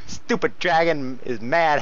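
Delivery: ordinary talk, nothing marked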